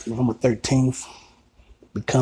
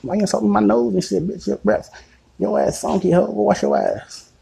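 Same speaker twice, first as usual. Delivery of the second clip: much higher mock woman voice; woman's voice; 10 semitones higher, 4 dB louder than usual. Imitating the woman firing back at Mike over his breath. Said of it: "She's like".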